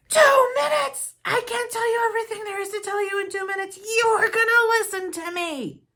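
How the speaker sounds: father outraged at only two minutes left; upset father. Retold or imitated. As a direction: play the dad's upset, shrill-free loud protest at full volume. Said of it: "Just upset. He was so upset".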